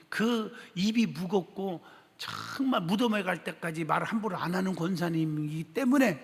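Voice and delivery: usual speaking voice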